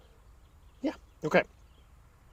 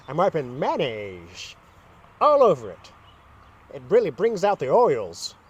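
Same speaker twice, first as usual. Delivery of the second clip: imitating a woman's talk. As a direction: mimic her proudly explaining her trick. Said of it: "this is exactly how she talked".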